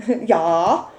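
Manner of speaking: Russian-sounding yeah; slipping into a Russian accent. Real, not acted. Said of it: "Why do I sound Russian?".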